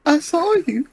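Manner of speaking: very high, slow accusing grandma. Acted. Grandma accuses grandson, very high and slow. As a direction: put on a high old-lady voice, accusing. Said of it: "He said".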